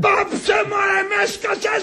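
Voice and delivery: high, fed-up outburst; exasperated shout. Acted. Husband sick of wife's talk of dying, exasperated.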